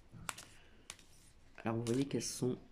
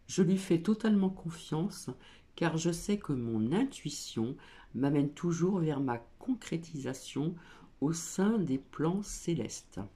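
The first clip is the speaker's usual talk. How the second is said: reading the card text aloud, a bit louder; 3 semitones higher, 5 dB louder than usual. Acted. Reader to her viewers, card text read out with some emphasis. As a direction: proclaim the card's words to the viewers.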